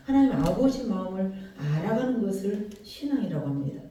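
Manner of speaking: ordinary delivery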